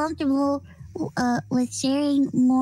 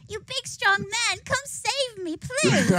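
mock damsel; 6 semitones higher than usual. Mocking damsel reply.

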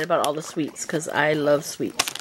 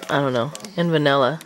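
normal talking voice

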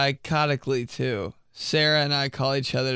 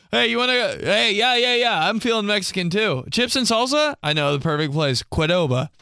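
higher, eager put-on parent voice; mock enthusiastic parent; chirpy parent impression; 8 semitones higher, 4 dB louder than usual. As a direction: pitch it up, over-keen to suggest dinner. Acting out parent suggesting Mexican food.

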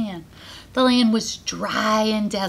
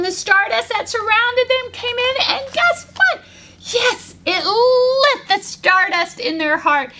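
very high, gushing narration; excited narration; 11 semitones higher than usual. A narrator's reading. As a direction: tell it with breaking joy. Climax of story, voice crying.

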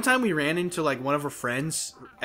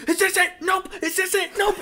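high, quick excited checking; teen rifling through movies in a hurry; frantic searching. Retold or imitated. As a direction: toss each one aside in a rush. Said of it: "and I was like".

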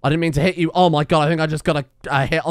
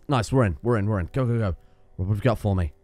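ordinary talk

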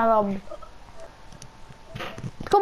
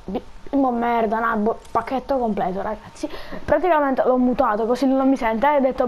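ordinary delivery

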